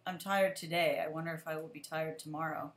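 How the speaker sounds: ordinary talk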